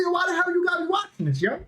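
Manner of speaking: high, fast, heated yell at his date; exasperated mock outburst; retold angry outburst. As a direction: snap it out like you're fed up. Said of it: "I'm getting pissed off. Watch, I say".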